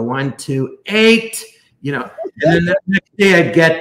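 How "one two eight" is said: surprise the patient with eight; playful count, eight shot up. Retold or imitated. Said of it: "So I go".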